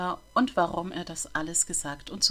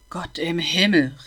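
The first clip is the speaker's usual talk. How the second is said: drawn-out dismayed cry, louder; slow scandalised outburst; mild exclamation; 9 dB louder, slower than usual. A narrator's reading. Old bookseller exclaims over the failing boy, louder.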